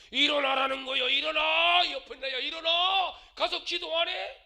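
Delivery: high, loud shout at his sleeping wife; husband yelling awake. Retold or imitated. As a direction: bellow it like a gruff husband rousing her. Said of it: "깨우더라는 거예요". (자는 아내를 깨우며 높고 크게 외치는 남편)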